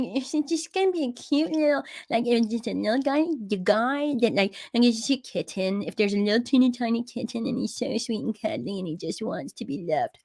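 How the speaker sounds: put-on soft, cutesy tiny-critter baby talk; puppy voice. Shown as a demonstration. Owner voicing any cute little critter, puppy voice. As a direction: coo as a cute little kitten. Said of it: "That's the voice for that".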